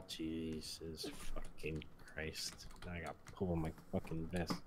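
normal talking voice

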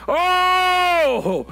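long high loud "Oh" held out; a holler of joy to the church; drawn-out preacher's cry. Real, not acted. Pastor crying out to the congregation, long and loud.

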